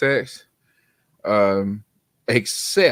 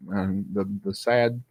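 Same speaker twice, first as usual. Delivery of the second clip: usual speaking voice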